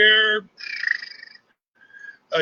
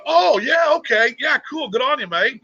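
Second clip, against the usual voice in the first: higher-pitched mock agreeable reply; imitated Australian mate; 7 semitones higher than usual. Australian mate hearing he is from Texas, imitated agreeable reply. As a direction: imitate a mate's easy approval, pitched up. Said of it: "And that always draws a".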